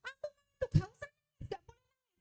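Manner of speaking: high-pitched mock parent, fading off; high imitation of a scolding parent; parent forbidding crying. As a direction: imitate a parent shutting down a child's tears. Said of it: "kita dulu sama orang tua mungkin nangis".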